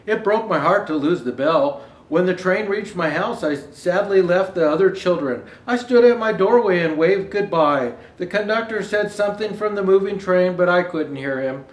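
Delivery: gentle read-aloud storytelling; story narration. A narrator's reading. Reader sharing the book with listeners, storytelling voice. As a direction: read the picture book to the audience.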